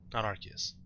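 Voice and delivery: "Arceus" with a hard K, as a soft aside; rejected pronunciation. Shown as a demonstration. Correcting the other way of saying it, "Arceus" with a K. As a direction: quietly dismiss "Arceus" said ARK-ee-us. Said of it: "pronounce it Arceus".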